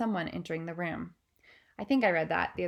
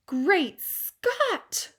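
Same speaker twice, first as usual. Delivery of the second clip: shocked cry; 11 semitones higher, 8 dB louder than usual. Acted. Queen reading the headline, shocked cry. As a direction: gasp then loud, higher cry. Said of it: "cried the famous voice".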